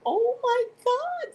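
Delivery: high, drawn-out excited cry; re-enacted delight. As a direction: gush it loud, high and slow. Re-enacts delight at her new name.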